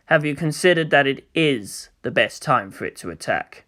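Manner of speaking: low, soft, precise rebuttal; cold commander. Acted. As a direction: turn her words against her.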